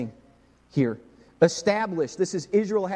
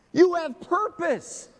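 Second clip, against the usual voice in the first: slow, much higher and louder proclamation; proclaiming; 14 semitones higher, 5 dB louder, much slower than usual. Acted. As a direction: declare it over them, slow and strong. Repeated proclamation.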